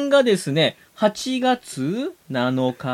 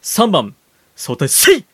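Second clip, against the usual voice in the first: ordinary talk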